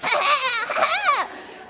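high frantic cackle; manic laugh. Retold or imitated. Wife out of her mind, cackling at her family. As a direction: laugh like you've lost it.

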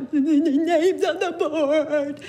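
slow, choked-up words. Retold or imitated. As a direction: force it out slowly through tears. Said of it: "I'm like".

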